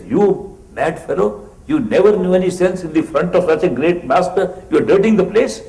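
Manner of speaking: father scolding his son for dirtying the ground before the master. Retold or imitated.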